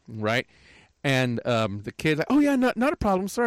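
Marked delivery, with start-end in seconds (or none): 2.3-3.5 put-on high, eager kid voice; mock the flustered young worker; lightly voiced eager teen; imitating the kid. Kid answers, quoted high.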